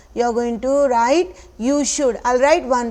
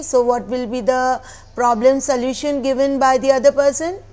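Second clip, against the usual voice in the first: ordinary delivery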